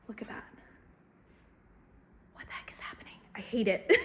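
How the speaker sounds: hushed, breathy complaint; whisper. Real, not acted. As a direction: whisper it under your breath. Whispering complaint about the weather.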